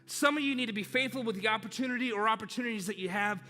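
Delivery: preaching at full force. Acted. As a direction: drive every word home from the pulpit.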